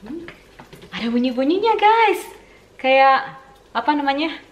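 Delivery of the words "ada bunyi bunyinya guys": excited. Real, not acted. Excited by fizzing in pot.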